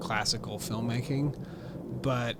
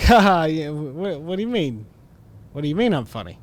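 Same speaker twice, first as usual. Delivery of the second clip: higher, louder, then probing; Pesci turning; 3 semitones higher, 8 dB louder than usual. Retold or imitated. Gangster questioning the compliment, half laughing. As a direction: laugh that goes tense. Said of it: "And he's like".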